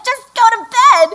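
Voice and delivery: put-on high, loud fed-up snap at the kids; a parent at the end of their rope; mock exasperated parent.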